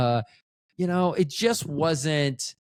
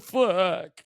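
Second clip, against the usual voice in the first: put-on much higher little boy's voice; 5 semitones higher than usual. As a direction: play a small child calling his dad. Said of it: "he said".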